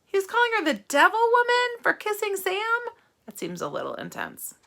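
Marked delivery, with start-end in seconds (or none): 0.0-2.9 much higher, disbelieving question; can you believe this?; high, amazed disbelief; incredulous. A narrator's reading. Reader incredulous to children.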